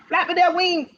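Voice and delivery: plain reading voice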